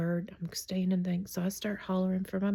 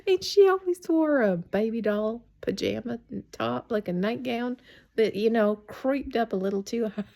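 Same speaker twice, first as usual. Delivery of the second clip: loud, laughing storytelling; real laughing while talking; 4 semitones higher, 6 dB louder than usual. Real, not acted. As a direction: crack up as you tell it, voice climbing high. Laughs while describing mother's wild look.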